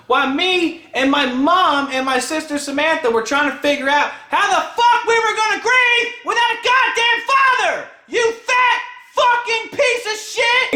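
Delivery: screamed insult at the end, almost sung; furious rant. Real, not acted. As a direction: explode at her in real fury. Ranting about aunt at funeral.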